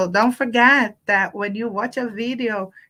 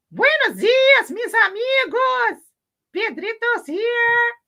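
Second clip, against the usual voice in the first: Pedrito puppet voice; 6 dB louder than usual. Acted. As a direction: greet the kids as the cheery puppet. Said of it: "Ven, Pedrito, ven".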